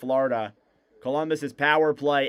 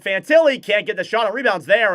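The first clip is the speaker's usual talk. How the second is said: excited call; 7 semitones higher, 8 dB louder than usual. Acted. Scramble in front of net.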